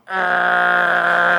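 loud growling dog imitation; a snarling guard dog sound.

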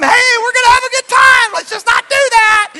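high, loud overly sweet warning; sugary fake calm. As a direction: pretend to be friendly while furious. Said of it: "I told him".

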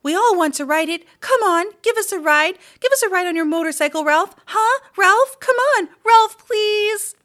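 very high, loud, fast pleading; little cousins begging Ralph for a ride; begging squeaky kids. A narrator's reading. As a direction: pester him like eager little kids.

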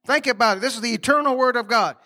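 usual speaking voice

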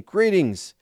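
a light narrated call, pitched well up; called-out greeting. A narrator's reading. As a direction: hail the king from a little way off. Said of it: "Ahimaaz called out and said to the king".

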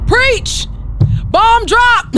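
high, loud self-hype shout. Real, not acted. Podcaster cheering her own punchline, high loud hype shout. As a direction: cheer your own point like a crowd.

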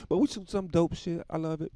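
normal talking voice

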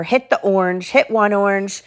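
plain reading voice

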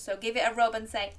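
ordinary delivery, nothing marked

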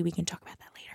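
confidential, under-the-breath aside; hushed aside. Real, not acted. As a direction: drop to a near-whisper. Suggestive aside after a joke.

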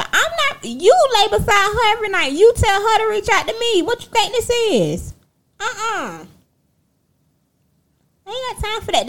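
high-pitched, sassy telling-off; indignant refusal. Retold or imitated. Answering her sister's girlfriend's plea to make up, indignant.

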